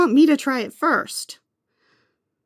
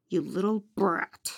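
muttered aside; 4 semitones lower, 9 dB quieter than usual. Acted.

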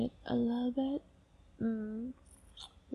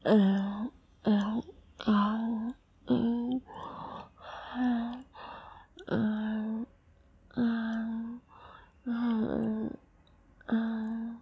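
rhythmic moans; much slower than usual. Acted. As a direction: pant little moans, steady beat. Rhythmic moaning sounds.

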